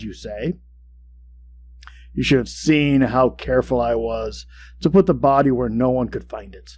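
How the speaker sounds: ordinary delivery, nothing marked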